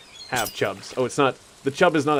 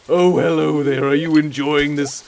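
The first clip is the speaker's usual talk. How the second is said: louder, slow, overly eager host; enthusiastic character; 4 dB louder, slower than usual. Acted. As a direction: beam at the viewers like a proud host. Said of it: "a character who tries to take credit".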